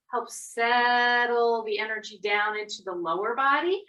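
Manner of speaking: usual speaking voice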